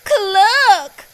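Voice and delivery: high, loud clucking hen; a bright hen's cluck. A narrator's reading. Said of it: "said Hen".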